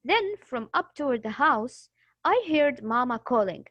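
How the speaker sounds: Then, from up toward the house, read with a clear pause at each comma. Shown as a demonstration. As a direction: stop for one second at every comma. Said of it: "when you see a comma, you will pause".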